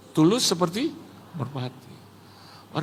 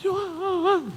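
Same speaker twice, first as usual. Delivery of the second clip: gasp, then a high drawn-out name; imitating an amazed congregation; 13 semitones higher, 4 dB quieter than usual. Whole congregation seeing the guest arrive, in imitated amazement. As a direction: gasp and coo the name in mock awe. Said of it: "semua jemaat gini".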